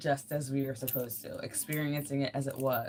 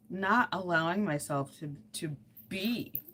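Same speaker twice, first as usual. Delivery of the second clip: ordinary talk, nothing marked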